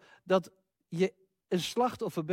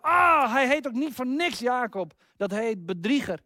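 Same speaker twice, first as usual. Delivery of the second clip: Esau shouting furiously; 6 semitones higher than usual. Retold or imitated. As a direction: yell in betrayed rage at the brother. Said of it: "schreeuwt Esau uit de huid".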